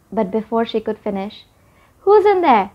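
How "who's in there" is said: high-pitched call from outside. A narrator's reading. Granddad to children inside the barn, calling out. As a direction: call sharply to whoever is hidden. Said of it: "called a voice they all knew from outside".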